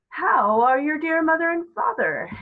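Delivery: put-on high, loud old lady voice; chirpy elderly actress, high and bright; Miss Spink. Miss Spink greeting Coraline, high old-lady voice. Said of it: "asked Miss Spink".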